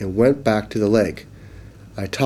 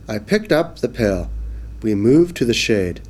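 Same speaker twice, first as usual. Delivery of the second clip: ordinary delivery, nothing marked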